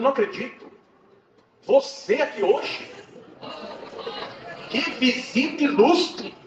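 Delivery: high, drawn-out exclamation of delighted disbelief; imitating an effusive doorman; amazed welcome. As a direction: greet an honoured guest, stretching the words slowly and loudly. Porteiro recebe o visitante com espanto.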